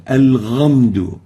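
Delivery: al-ghamdu with a voiced ghain instead of ha. Shown as a demonstration. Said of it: "or with a ghain".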